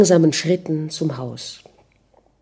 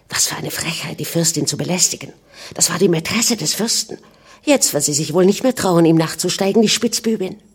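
ordinary delivery, nothing marked

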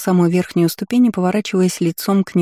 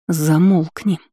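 menacing; much slower than usual. A narrator's reading. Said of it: "угрожающе шагнула я".

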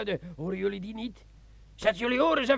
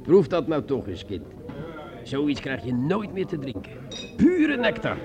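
much lower, sly and intimate; low seductive sergeant; 10 semitones lower than usual. Acted. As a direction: coax her into drinking, low and sly. Sergeant speaks low to Margot. (sergeant fluistert laag en verleidelijk tegen Margot)

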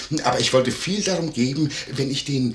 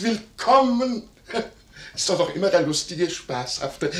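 effusive welcome; 7 semitones higher than usual. Acted. Innkeeper welcomes Werner a second time, exuberantly stretched. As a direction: sing out the greeting with gusto.

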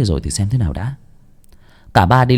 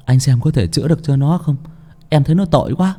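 put-on higher young man, full of pity; 6 semitones higher than usual. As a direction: ask for the poor boy. Bảy Ếch pitying. (giọng thanh niên cao, thương cảm)

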